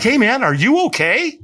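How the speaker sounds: imitating a friendly guy asking if she's okay; concerned stranger. A stranger at the firewalk asks if she's okay, lightly voiced concern. Stranger checks on hiding speaker.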